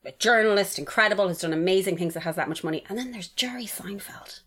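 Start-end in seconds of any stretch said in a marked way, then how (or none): 2.9-4.4 slow whisper; hushed reveal. Real, not acted. Comparing Oprah and Seinfeld, hushed slow reveal. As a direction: drop to a whisper for the name.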